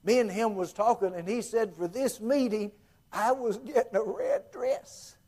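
high earnest country woman voice; Helen's voice. Retold or imitated. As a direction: raise your pitch like a simple devout woman. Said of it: "that's the way she talked".

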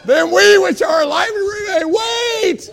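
high, shouted scripture proclamation; preaching. Real, not acted. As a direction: belt out the verse to the congregation. Preaches scripture at full force.

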